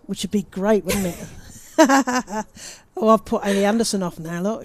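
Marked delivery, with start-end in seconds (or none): 1.3-2.7 high loud burst of laughter. Real, not acted. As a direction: laugh out at the joke. Laughs at body doubles joke.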